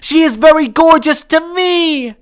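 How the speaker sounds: loud, high, defiant yell; defiant shout. Acted. Boy yelling back at his family about his anime crush, defiant.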